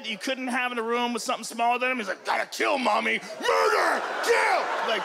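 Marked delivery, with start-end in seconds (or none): 2.2-4.6 mock-demonic little boy, increasingly shrill; murderous kid. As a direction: play a crazed kid, climbing into a shout. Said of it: "Imagine if your kid did that".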